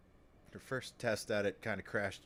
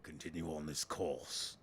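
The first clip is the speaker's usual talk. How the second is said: menacing city leader; 4 semitones lower than usual. Acted. Leader to striking workers, low and menacing.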